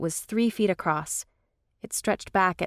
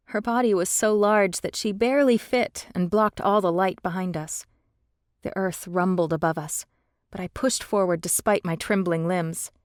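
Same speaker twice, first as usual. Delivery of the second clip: plain reading voice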